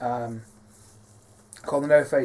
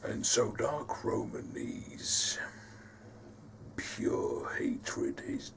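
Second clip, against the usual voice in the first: deep, whispery growl, slow and soft; Dark Roman; 3 semitones lower, 10 dB quieter, slower than usual. Shown as a demonstration. Creator explaining the character while staying in his deep, growly voice. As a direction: hold a low, menacing hushed growl. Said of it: "when I do this voice".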